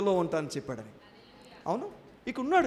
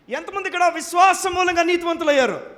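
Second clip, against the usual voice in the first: shouting; 9 semitones higher, 11 dB louder than usual. Real, not acted. Preacher challenging silent congregation.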